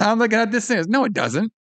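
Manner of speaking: exaggerated incredulous retort; mock outburst. Mocking reaction to the doll.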